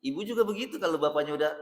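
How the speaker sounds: ordinary talk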